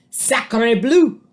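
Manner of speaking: put-on loud French-accented cry; a dramatic French duck; French duck exclamation. A narrator's reading. Duck cries out, loud French accent. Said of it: "cried the duck".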